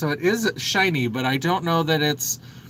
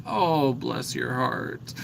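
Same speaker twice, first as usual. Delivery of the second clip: soft, slow Southern drawl on "bless your heart"; sweetly Southern, slow and soft; a light Southern "Oh, bless your heart"; 3 semitones lower, 4 dB quieter, much slower than usual. Shown as a demonstration. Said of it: "I embrace that sort of Southern".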